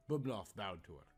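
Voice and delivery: ordinary delivery, nothing marked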